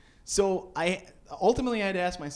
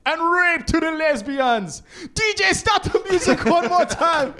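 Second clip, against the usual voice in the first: much higher, louder, slow patois hype; mock Jamaican shout; 12 semitones higher, 9 dB louder than usual. [name] shouting to the crowd and DJ, loud mock Jamaican. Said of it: "he goes".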